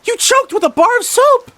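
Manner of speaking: very high, loud shriek; acted hysterical alarm; panicked. A narrator's reading. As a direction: shriek in horror at what happened. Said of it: "panicked Missus Lorraine".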